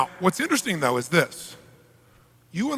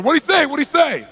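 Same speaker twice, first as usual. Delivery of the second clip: much higher and louder demand; frantic boss; 9 semitones higher, 5 dB louder than usual. Retold or imitated. Mafia boss shouting at the interpreter, much higher. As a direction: yell for the answer. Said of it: "He turns to the interpreter and says".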